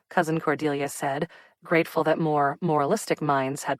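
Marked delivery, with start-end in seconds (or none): none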